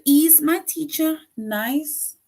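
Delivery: high rising question on "nice"; question tune. Shown as a demonstration. Teacher reading a question aloud to the class, rising tune. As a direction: voice climbs up to "nice?" like a real question. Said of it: "It ends with a question mark".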